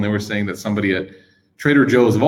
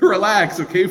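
high, slow laughing relax; amused high-pitched calm down; 10 semitones higher, slower than usual. Retold or imitated. Laughing at friend's fuss.

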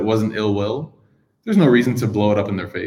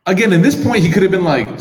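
excited; 9 semitones higher, 6 dB louder than usual. Real, not acted. Excited aside.

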